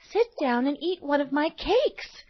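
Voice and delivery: put-on high, sweet little girl asking kindly. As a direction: play a gentle young girl.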